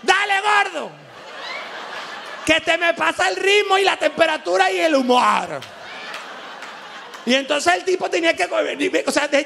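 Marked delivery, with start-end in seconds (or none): 0.0-5.6 higher shouted pleading, laughing; impatient wife. Retold or imitated. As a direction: hurry him up, half shouting through laughter. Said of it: "Ya la mujer está empatillada".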